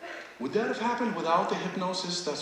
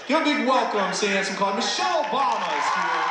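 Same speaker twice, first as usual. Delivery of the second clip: higher, loud announcer welcome; big announcer intro; 6 semitones higher, 3 dB louder, faster than usual. Acted. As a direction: call out the guest like a host. Announcing guest to audience.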